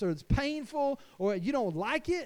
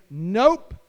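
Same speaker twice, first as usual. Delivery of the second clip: firm loud nope; 9 dB louder than usual. Pretend. Father refusing the enemy.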